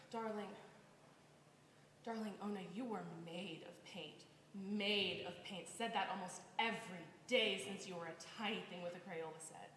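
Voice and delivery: tender. Acted. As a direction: gently comfort a grown child. Aunt tenderly comforting niece.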